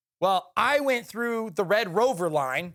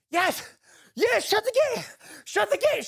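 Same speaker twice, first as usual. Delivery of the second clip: high, breathless mock urgency; out of breath; 13 semitones higher than usual. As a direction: imitate a winded, urgent radio order. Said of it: "like, I'm out of breath".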